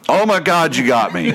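high-pitched retold yelp, dropping off; mock exclamation. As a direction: imitate a buddy crying out as he gets hooked. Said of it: "he exclaims that".

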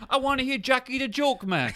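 high squeaky complaint; joking crewman voice; mock whiny crewman. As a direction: whine it high like a cartoon sailor.

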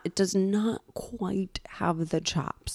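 slow whispered aside; whispering. Real, not acted. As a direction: whisper the verdict.

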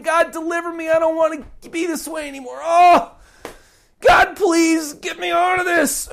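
high, urgent cry to God; voiced sinner begging for release; pleading prayer. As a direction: beg God to deliver you. Desperate prayer voiced for the listener.

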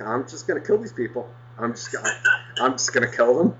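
thought kept under the breath, softly; inner seething. Retold or imitated. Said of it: "all of this is going on in my head".

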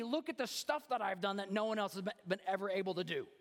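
high, driving pulpit delivery; high-pitched, forceful sermon voice; impassioned preaching. Acted. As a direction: preach it with rising urgency.

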